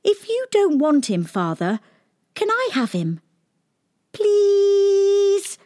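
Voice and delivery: very high, pleading little princess; cartoon princess. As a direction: beg sweetly in a tiny high voice. Princess begs for the dog.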